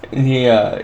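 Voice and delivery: ordinary delivery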